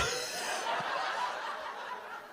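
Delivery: a long, airy fit of high laughter; high breathy laugh. Real, not acted. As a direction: break up laughing in disbelief. Asked to pee for real.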